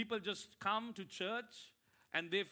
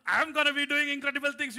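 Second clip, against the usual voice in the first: boastful shout; 9 semitones higher, 16 dB louder than usual. Retold or imitated. Mocking prideful hearing.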